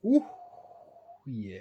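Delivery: normal talking voice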